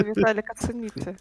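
ordinary delivery

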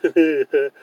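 a short light giggle. A narrator's reading. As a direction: giggle fondly. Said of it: "Mama giggled".

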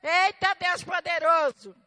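high, loud cry of praise, drawn out at the end; loud praise exclamation. Real, not acted. Preacher exclaims at God's power, loud praise. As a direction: call out in awe to the congregation.

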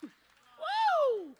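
very high, loud whoop; a joyful holler. Real, not acted. Preacher whoops after the altar call, high.

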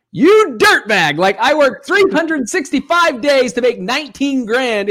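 blowing up in jealous disbelief, hammed-up; mock outrage. Envious outburst at a rival's windfall.

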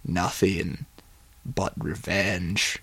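hushed, slow temptation; sinister suggestion. Acted. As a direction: plant the idea softly, pausing midway. Tempters answer Johnny's despair.

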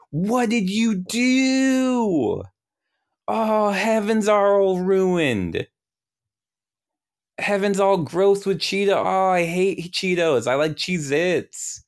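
high, drawn-out whiny wail; hammed-up falsetto lament of an upset deity; distraught God. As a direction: wail in dismay, stretching the words way up high. God wailing over ruined heaven.

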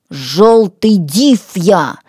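slow, drawn-out ДИВ, falling firmly; menacing declaration. Acted. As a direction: announce yourself ominously as the demon. Threatening self-introduction as a demon.

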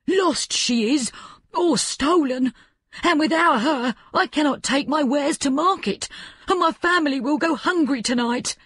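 put-on a poor old man begging for help; old peasant man. A narrator's reading. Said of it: "he explained".

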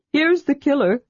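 high-pitched storybook sidekick; eager kender voice. Acted. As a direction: pitch it high, like an excited small adventurer. Tass voiced in story dialogue.

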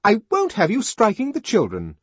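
loud, high, indignant nurse; stern scolding. A narrator's reading. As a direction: put him in his place.

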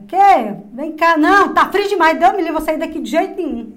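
loud, high call from a distance; neighbour calling back. Retold or imitated. Neighbour shouts back that it's too cold, loud and high. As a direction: shout back without leaving the tent. Neighbour answers from her tent.

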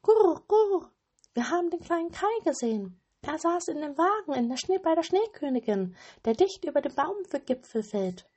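very high, loud fast bird voice; cooing wood pigeons. A narrator's reading. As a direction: coo it like a pigeon sharing news. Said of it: "sprachen die Holztauben". (sehr hohe, schnelle Taubenstimme)

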